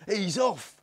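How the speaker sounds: put-on high, loud boy's shout; excited kid hollering from the pit; shrill cry. A narrator's reading. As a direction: yell it high as the chase starts. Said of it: "with shrill cries".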